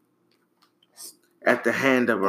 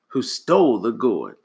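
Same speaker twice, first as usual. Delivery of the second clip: ordinary delivery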